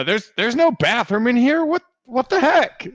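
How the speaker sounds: high, slow, baffled worker; bewildered. Acted. Worker baffled by the kid, high and slow. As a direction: sputter in confusion.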